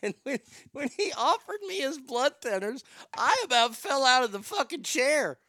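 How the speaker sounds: high, laughing through the words; can barely get it out for laughing. Real, not acted. Laughing while retelling the story.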